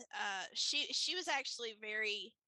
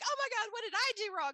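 high, loud, fast imitated alarm; mock panic; 12 semitones higher, 5 dB louder, faster than usual. Friend alarmed at being refused, mock panic.

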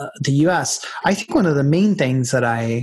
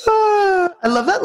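ordinary talk

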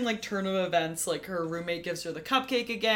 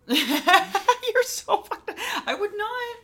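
high, slow words through real laughter; laughing; 13 semitones higher, 5 dB louder, much slower than usual. Real, not acted. Cohost reacting to the throw-it-up plan, laughing through the words. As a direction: laugh it out in disbelief.

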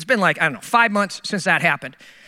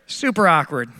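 dry sarcasm; 5 semitones lower, much slower than usual. Retold or imitated. Sarcastic self-commentary.

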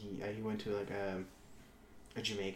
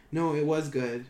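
louder, insistent mock argument; defiant contradiction; 4 semitones higher, 8 dB louder, slower than usual. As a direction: imitate an owner stubbornly talking back. Acted quarrel, owner contradicts Ramsay.

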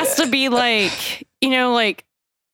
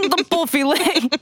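put-on Australian accent; 6 semitones higher than usual. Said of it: "I'm trying to do an Australian accent".

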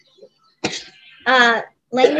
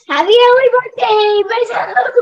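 excited; 8 semitones higher, 6 dB louder than usual. Real, not acted. Child cheering own birthday.

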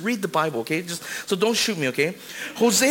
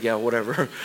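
bored eye-roll; 9 semitones lower than usual. Retold or imitated. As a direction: shrug it off like a rolled-eye wife. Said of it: "she just rolled her eyes".

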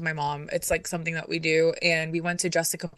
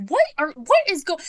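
high-pitched baffled questioning; mock bewildered, pitched way up; retold alarm; 10 semitones higher, 4 dB louder than usual. Mom seeing her daughter bawl, retold alarm. Mom's reaction to daughter crying.

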